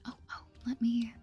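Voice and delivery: ordinary talk, nothing marked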